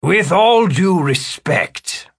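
high, slow, put-on character voice; pointed formal reply. Wizard answering the hovering examiner, slow high formality. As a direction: stretch out the courtesy, pitched high. Philip answers the ceremonial challenge.

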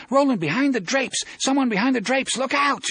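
high, frantic warning shout. A narrator's reading. Jake warns Roland someone is hiding, frantic shouting. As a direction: warn him of hidden danger at full urgency. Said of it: "he shouts".

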